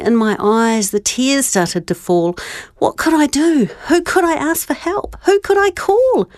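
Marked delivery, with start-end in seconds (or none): none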